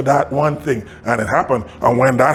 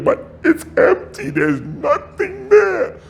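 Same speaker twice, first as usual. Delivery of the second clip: much higher, breathless helper protesting, slowing down; worried helper; slower than usual. Retold or imitated. Helper protesting to Roy at the empty pan, high and doubtful. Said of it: "The guy goes".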